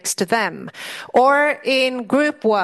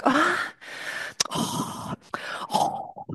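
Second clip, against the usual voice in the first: a rising "ah", then breathy whispered "oh, oh"; frustrated groan; 7 semitones higher, 6 dB quieter, much slower than usual. Retold or imitated. Students can't follow an accent, breathy frustrated groan. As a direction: sigh out the struggle to follow an accent. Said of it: "And we go like".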